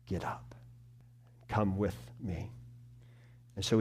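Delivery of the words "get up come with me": soft, near-whispered comfort; barely voiced, gently urging them up; a hushed, loving invitation; tender whisper. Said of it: "He says".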